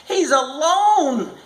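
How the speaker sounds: high, slow desperate cry; frantic mother. A narrator's reading. Mother crying out at the fire, high slow desperate. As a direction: cry it out in fear for the child. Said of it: "the mother cried".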